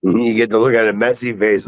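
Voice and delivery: ordinary talk, nothing marked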